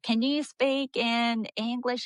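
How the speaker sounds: imitated Southern accent, slowed right down. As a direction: stretch it out like a Georgia Southerner. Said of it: "the Carolinas or Georgia or something".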